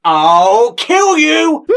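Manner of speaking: slow, roaring fake rage at the co-host; mock death threat. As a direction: bellow it out like a cartoon villain. Mock fury at co-host's teasing.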